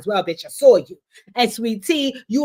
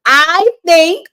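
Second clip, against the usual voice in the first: dramatic build-up; 7 semitones higher, 8 dB louder than usual. Acted. Dramatic slow reveal of theory.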